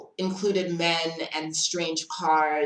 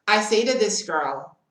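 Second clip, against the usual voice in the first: ordinary talk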